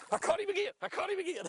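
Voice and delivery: crowing with glee; excited. Real, not acted.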